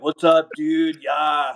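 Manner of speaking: easy-going SoCal surfer accent; SoCal dude. Shown as a demonstration. [name] showing off his Southern California accent, laid-back. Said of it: "I talk with a Southern California accent".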